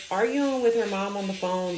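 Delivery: ordinary talk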